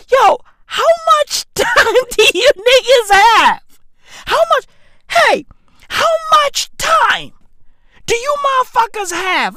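high, loud, incredulous outburst; exasperated shout. Real, not acted. Comedian ranting at celebrity-obsessed people, exasperated yelling. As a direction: yell it high in disbelief.

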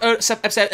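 "episode" stumbled over, breaking into babble; tongue-tied babble. Real, not acted. Host wrapping up the episode, "episode" fumbled into babble. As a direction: trip over "episode" into goofy nonsense syllables. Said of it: "I don't know what that was".

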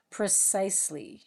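plain reading voice

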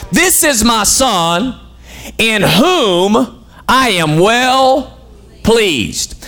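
drawn-out, weighty divine declaration; slow majestic voice from heaven; slow solemn voice of God. Acted. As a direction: let each word of the Father hang, very slow. Preacher voicing the Father from heaven.

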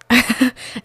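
short laugh. Real, not acted. Laughing at herself.

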